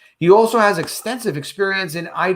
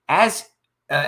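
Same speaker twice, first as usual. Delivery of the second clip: normal talking voice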